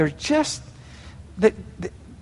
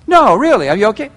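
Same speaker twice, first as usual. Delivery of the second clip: higher, louder, pressing question; insistent concern; 5 semitones higher, 6 dB louder, faster than usual. Acted. Spouse probing a sulking partner, insistent concern. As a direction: press them for a real answer.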